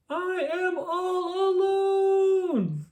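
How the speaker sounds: panicked cry stretched out; scream. A narrator's reading. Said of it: "screamed Toad".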